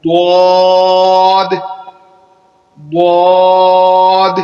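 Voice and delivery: واد thick and full-mouthed, loud and very slow; واد as emphatic daad; heavy letter. Shown as a demonstration. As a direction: weigh واد down, tongue at the teeth side. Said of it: "موٹا کر کے اس کو پڑھیں گے".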